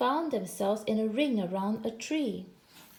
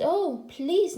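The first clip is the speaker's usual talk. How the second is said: raised, louder storybook plea; pleading; 6 semitones higher, 5 dB louder than usual. A narrator's reading. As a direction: beg with a lifted, drawn please. Boy begs the second tiger.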